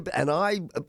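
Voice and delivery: ordinary delivery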